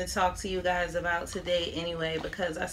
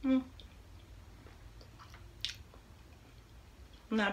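talking while chewing; 2 semitones higher, 12 dB quieter than usual. Real, not acted. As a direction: mouth full of food. Seen 1: Bites rangoon at -9; closed mouth, chewing through the words.

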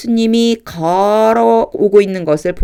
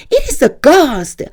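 frightened cry; 9 semitones higher, 5 dB louder, slower than usual. Acted. Disciples cry out in terror.

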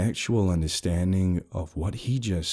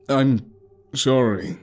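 scared apology; slower than usual. A narrator's reading. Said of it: "sudden change in his tone".

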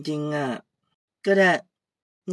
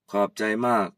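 put-on much lower, easygoing old man greeting; old uncle; 6 semitones lower than usual. Old uncle greeting Kaew, much lower. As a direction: drop low like a relaxed old uncle.

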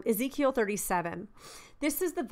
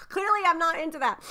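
giggling, much higher, self-mocking; laughing at herself; 6 semitones higher, faster than usual. Real, not acted. Preacher laughing at her own word slip, giggling.

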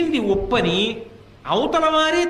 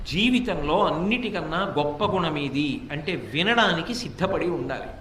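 normal talking voice

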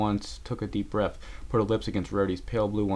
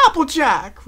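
high, loud alarmed call; Rarity crying out; 9 dB louder, slower than usual. A narrator's reading. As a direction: shriek her friend's name. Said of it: "Rarity cried".

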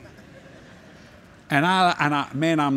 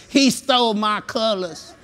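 mock jealous whine; 5 semitones higher, slower than usual. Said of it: "I didn't say".

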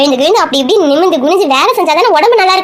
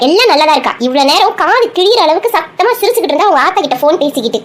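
ordinary delivery

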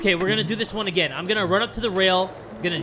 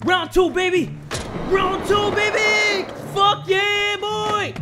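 high, loud rallying cries; hyped-up shouting; 12 semitones higher, 4 dB louder, slower than usual. Real, not acted. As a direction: pump yourself up for the next round. Hypes self for another try.

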